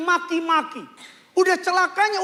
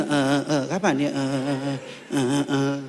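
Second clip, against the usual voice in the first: husband fumbling; 12 semitones lower than usual. Retold or imitated.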